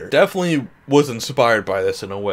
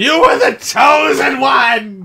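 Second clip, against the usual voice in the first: shouting; 10 semitones higher, 6 dB louder than usual. Real, not acted. Shouting at Pooh on screen.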